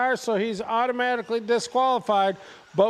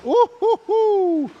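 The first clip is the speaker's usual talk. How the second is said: excited whoop; 10 semitones higher, 5 dB louder, slower than usual. Real, not acted. Rival closing in on leader.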